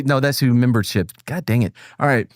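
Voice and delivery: usual speaking voice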